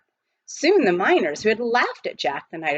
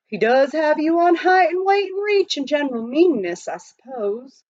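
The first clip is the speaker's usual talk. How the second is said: higher, louder townsman's voice; lightly voiced undertaker; undertaker's warning; 9 semitones higher, 5 dB louder than usual. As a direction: size him up with a sly warning. Undertaker warns Praiseworthy.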